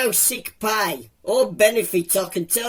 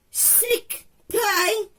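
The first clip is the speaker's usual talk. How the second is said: outraged disbelief; 11 semitones higher, 3 dB quieter than usual. Acted. Johnny outraged, high squeak.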